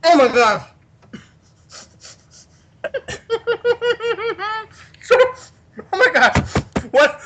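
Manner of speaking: overwhelmed with giggles; squealing oh my god through laughter; laughing excitement. Real, not acted. Bursting with laughter.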